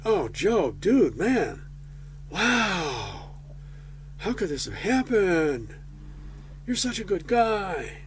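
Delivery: mock sympathy. Friends consoling Job, mock gushing sympathy. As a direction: pour on fake concern like a chummy buddy. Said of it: "instead of saying".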